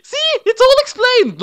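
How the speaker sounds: a squeaky comic aside to the listener; silly aside. Acted. Mocking aside, high put-on voice.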